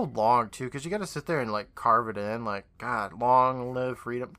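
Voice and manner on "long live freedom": slow, drawn-out words; mock laborious carving. Co-host mocks carving it into a wall, laboriously slow. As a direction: drag each word out as if carving it.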